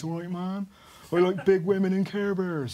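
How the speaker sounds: lightly voiced laid-back mate; imitating Will. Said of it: "speaks like that".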